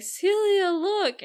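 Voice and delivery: thrilled to show Celia the poems; excited Rosalind. Retold or imitated. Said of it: "Rosalind is like".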